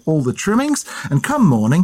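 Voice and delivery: ordinary talk, nothing marked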